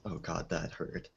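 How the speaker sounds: ordinary delivery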